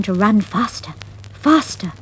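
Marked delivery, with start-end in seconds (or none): none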